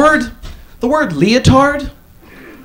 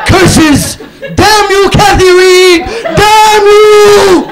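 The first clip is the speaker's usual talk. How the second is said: hammed-up cursing shout, high and loud; mock villain rage; 10 semitones higher, 5 dB louder, much slower than usual. Reagan in his office cursing the mom, mock furious shout. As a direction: rage at the ceiling like a cartoon villain. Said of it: "Ronald Reagan sitting around the office like".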